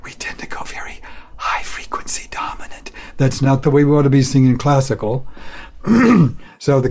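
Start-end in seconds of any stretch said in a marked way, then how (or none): 0.0-2.9 airy whisper, no voice at all. Shown as a demonstration. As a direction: whisper the line to show the high hiss. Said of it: "when we whisper".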